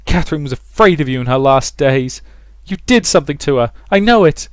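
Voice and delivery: loud, high, climbing anger; bitter accusation. A narrator's reading. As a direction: accuse him with mounting bitterness. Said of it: "uncharacteristic bitterness in her words".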